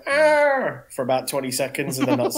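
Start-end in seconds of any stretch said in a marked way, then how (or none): 0.0-0.8 high excited yelp; excited gasp. Retold or imitated. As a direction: gasp it out like a thrilled fan. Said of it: "And you go".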